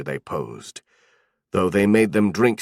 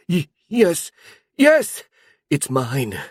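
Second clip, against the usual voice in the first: panicked cry; 7 semitones higher, slower than usual. A narrator's reading. Tortured sorcerer answers the goblin shaman in panic. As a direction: blurt it out in sheer panic, voice cracking upward. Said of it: "Zeno cried in a panic".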